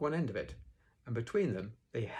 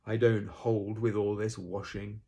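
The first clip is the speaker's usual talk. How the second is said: slow, louder grumble; Eeyore grumbling; 5 dB louder, slower than usual. A narrator's reading. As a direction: grumble it out slowly in disapproval. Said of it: "grumbled Eeyore".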